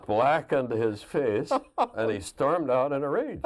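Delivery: loud, high, laughing as he tells it; real laughter. Real, not acted. Son recalling the general's exploding cigarette, laughing hard. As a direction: push the words out through big laughs. Laughing over his own prank story.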